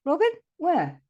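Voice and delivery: loud, high baffled question; puzzled searching. Retold or imitated. Bloke looking up for the robin, puzzled searching. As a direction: imitate a man hunting for what he can't see. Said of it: "He went".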